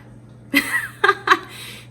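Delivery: a sudden loud laugh at herself; loud high laugh. Real, not acted. Laughs after apology.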